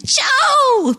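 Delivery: high, gushing bad American accent greeting Joe. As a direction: cry out the name in a hammed-up American accent. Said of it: "Very bad American accent".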